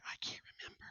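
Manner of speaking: whispered, embarrassed admission; sheepish whisper. Retold or imitated. Owning up to interviewers he forgot, whispered. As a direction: drop to a guilty whisper. Interviewers quizzing his old code.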